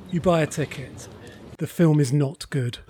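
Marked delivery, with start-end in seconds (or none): none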